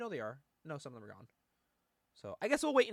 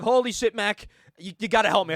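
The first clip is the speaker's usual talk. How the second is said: loud, higher mock panic; Wolverine; 5 semitones higher, 10 dB louder than usual. Hero begging his friend Mac, loud mock desperation. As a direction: beg a pal for help, hammed up. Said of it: "he's like".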